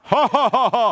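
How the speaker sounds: loud booming ho ho ho; giant laugh. Acted. Goliath mocking the short Israelites, giant laugh. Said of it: "Ketawanya bener-bener ketawa raksasa".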